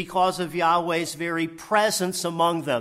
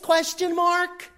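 high, slow, drawn-out question mark; pointed rhetorical aside; emphatic aside; 14 semitones higher, 5 dB louder than usual. Acted. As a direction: lean on it like a punchline.

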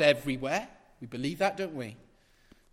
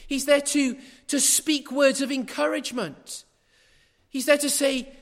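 impassioned preaching; 8 semitones higher, 4 dB louder than usual. Acted. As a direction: lift them up with each line. Rising preaching build.

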